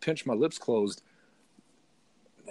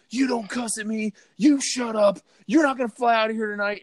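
higher, sharp imitation of the angry TSA guy; mock him yelling orders, raised and pushy; freaking out; 8 semitones higher, 4 dB louder than usual. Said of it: "proceeded to freak out at me and say".

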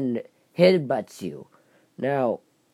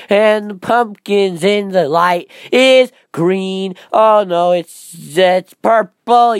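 loud, slow, high declaiming; hammy theatrical character; 4 semitones higher, 9 dB louder, slower than usual. Pretend. Vlogger answering what is wrong with my brain, loud hammy declaiming. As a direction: declaim the nonsense grandly, drawing out words. Absurd acted dialogue with himself.

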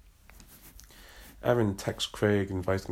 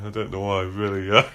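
laughing; 8 dB louder than usual. Real, not acted.

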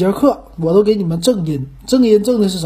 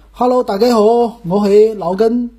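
higher sing-song Cantonese greeting; Cantonese show opening; 3 semitones higher than usual. Shown as a demonstration. Host trying a changed opening, Cantonese greeting. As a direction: open the show in amateur Cantonese. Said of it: "我改一改，我就说".

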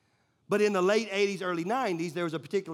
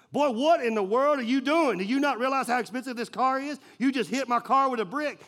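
loud, high furious scolding; angry yell; 7 semitones higher, 6 dB louder than usual. Retold or imitated. Football player scolding the boy who hit his car, angry yell. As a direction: bellow at the kid like he just dented your pride.

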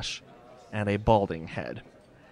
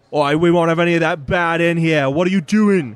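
loud, high gruff Cockney barman shouting; loud angry barkeep; gruff bartender; 6 semitones higher, 11 dB louder than usual. Acted. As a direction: bark at them like a grumpy publican. Bartender character voice.

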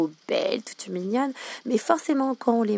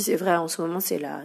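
ordinary talk

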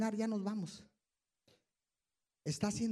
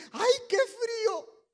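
high, louder mock whine; mock complaint; 10 semitones higher, 5 dB louder, slower than usual. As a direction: whine about the cold. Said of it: "te dicen". (queja aguda y burlona por el frío)

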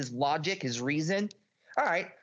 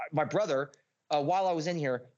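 usual speaking voice

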